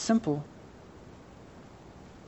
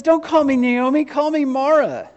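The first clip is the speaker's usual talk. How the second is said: Naomi bitter; 10 semitones higher, 3 dB louder than usual. Acted. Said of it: "she responds and she says".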